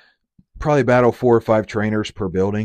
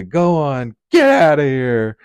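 raised, slow and loud, sneering "get outta here"; mean shoo-away voice; 6 semitones higher, 7 dB louder, slower than usual. Retold or imitated. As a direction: shoo it off like a bully, slowly. Mocking someone shooing a creature.

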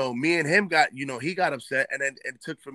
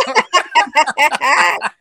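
loud high laugh; 13 semitones higher, 9 dB louder than usual. Real, not acted. Laugh after April's retort.

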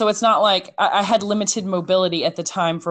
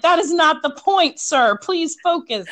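higher, mock-exasperated scolding; 9 semitones higher than usual. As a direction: tell him off with pretend patience. Exasperated reply to a neighbour.

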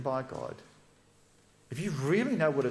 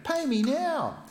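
high, slow, louder demand; 13 semitones higher, 3 dB louder, slower than usual. Acted. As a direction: bear down on him, slow and pushing. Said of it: "demanded instant payment".

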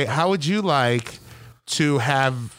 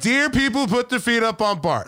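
mock open letter; 6 semitones higher than usual. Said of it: "I wasn't like".

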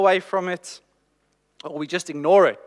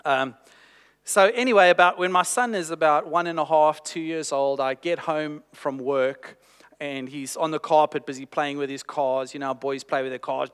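usual speaking voice

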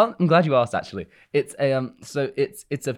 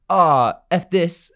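louder, higher imitation of exasperation; mock fed-up; 5 semitones higher, 5 dB louder, much slower than usual. Other Barbies rebel against Ken, mock fed-up. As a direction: throw it out in mock disgust. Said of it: "gonna be like".

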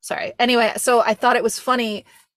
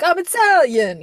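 high, loud, proud declaration; mock Italian-American boast, pitched up; boastful Italian-American; 8 semitones higher, 6 dB louder than usual. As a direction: announce it loudly like a heritage brag. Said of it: "we're like".

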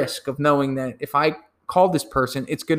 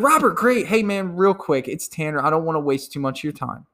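eager salesman pitch. Shown as a demonstration. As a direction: sell it like you're already his buddy. Acted cold call to Robert.

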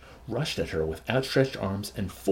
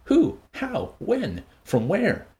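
eager questions; 10 semitones higher, much slower than usual. Acted. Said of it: "Bernard's questions made a diversion".

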